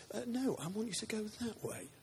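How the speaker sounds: high, soft correcting voice; God speaking. Acted. God redirecting a would-be evangelist, high and softly insistent. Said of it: "but God says".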